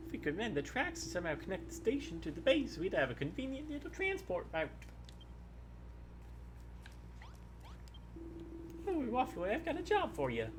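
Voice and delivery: put-on folksy old miner giving a job; old prospector. Reads the NPC lines aloud.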